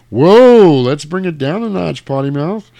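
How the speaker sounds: high, loud "whoa", then high-pitched chiding; mock taken aback. Priest scolding the foul-mouthed devil, mock startled reproof. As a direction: recoil comically from the swearing.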